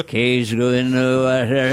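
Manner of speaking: put-on slow Quint accent from Jaws; drawn-out old sea captain line; Robert Shaw impression. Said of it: "Robert Shaw in Jaws".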